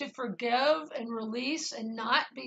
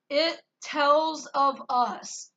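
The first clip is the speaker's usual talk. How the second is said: "It tells of us" very slow and louder, each word apart; 3 semitones higher, 6 dB louder, much slower than usual. Shown as a demonstration. Quoting words heard on waking from a dream, said word by word. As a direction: space out "It", "tells", "of", "us". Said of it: "like it was being said instead of".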